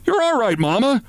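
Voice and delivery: high calling shout to calm mother. Acted. Son shouting to calm his frightened mother, high calling voice. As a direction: call out over the noise to soothe her. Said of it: "he shouted".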